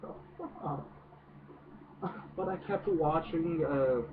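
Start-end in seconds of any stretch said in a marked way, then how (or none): 0.0-0.9 muttered disbelief. Retold or imitated. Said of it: "And I'm just like".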